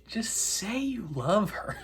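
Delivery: high, drawn-out breathy plea; mock pleading. Recapper urging the boy to confess his love, mock pleading. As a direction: beg in a slow, high, soft voice.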